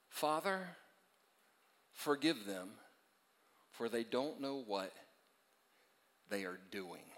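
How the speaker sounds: soft, halting prayer, falling low; hushed prayer. Acted. Jesus praying for his crucifiers, hushed and solemn. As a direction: pray it softly with long pauses.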